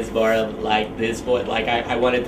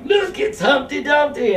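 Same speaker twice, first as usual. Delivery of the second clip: yelling heckler. Retold or imitated. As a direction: yell it at the puppeteer like a heckler. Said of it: "it turned into a yelling match".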